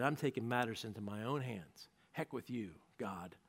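low, quiet, menacing decision; cold resolve. Acted. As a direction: mutter the murder plan darkly. Saul's rage turns to murder.

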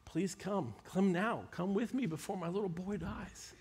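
soft, hushed desperate plea; pleading father. Retold or imitated. Father pleading quietly with Jesus for his dying boy. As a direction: quietly beg him to come before it's too late. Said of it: "the man pleaded".

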